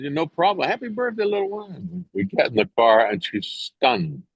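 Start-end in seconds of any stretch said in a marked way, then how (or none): 0.0-2.0 much higher, sweet and softening; Kelly saying goodbye. Retold or imitated. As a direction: fade to a tender goodbye to a child. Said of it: "She goes".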